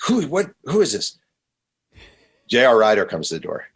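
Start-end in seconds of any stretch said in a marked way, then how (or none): 0.1-1.2 high, startled voice from inside; imitated yelling. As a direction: mimic a voice shouting from inside. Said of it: "two people yelling in the background".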